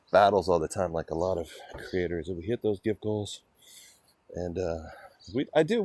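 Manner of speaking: ordinary talk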